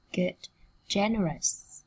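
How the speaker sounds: normal talking voice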